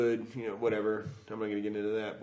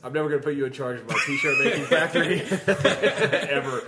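laughing shout; 3 semitones higher, 6 dB louder than usual. Real, not acted. S0 laughing while teasing.

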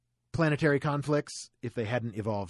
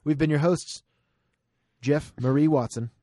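announcer; 3 semitones higher, 5 dB louder, faster than usual. Acted. Podcast outro announcement.

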